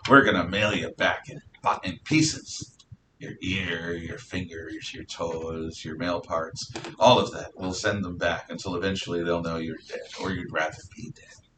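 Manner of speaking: low, soft pirate menace; lightly voiced pirate threat. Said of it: "I'm gonna use the pirate voice".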